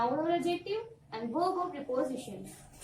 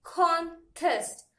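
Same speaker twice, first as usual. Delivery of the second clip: "Contest" loudly split into con, test; syllable by syllable; 5 dB louder than usual. Shown as a demonstration. Teacher repeats the exercise for students, syllable by syllable. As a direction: pause between the two beats of "Contest". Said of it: "do the same exercise".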